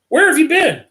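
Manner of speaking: high, loud demand of a worried mother; exasperated mother. A narrator's reading. Mother to Sam who had wandered off, exasperated. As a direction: pitch it up sharply, scolding. Mother scolds missing son.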